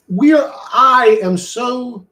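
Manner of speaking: ordinary talk, nothing marked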